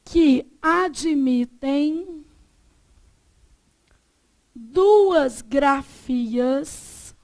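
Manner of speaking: very slow dictation, long pauses between phrases; dictating. Real, not acted. Teacher dictating a definition to the class, slowly. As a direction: dictate word group by word group, stretching syllables.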